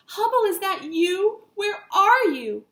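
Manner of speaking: high, loud calling. Acted. Marty calling for his dog Hubble, loud and high. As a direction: call across space for the dog.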